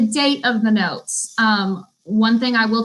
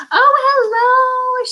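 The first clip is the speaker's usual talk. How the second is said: sweet high hello; 14 semitones higher, 4 dB louder, much slower than usual. Retold or imitated. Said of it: "She was just like".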